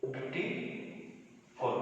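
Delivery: Beautiful very slowly, long gaps between its parts; teacher's slow, quiet split of beautiful; broken into syllables. Shown as a demonstration. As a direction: softly draw beautiful apart, syllable by syllable. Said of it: "जिसमें कई syllable है".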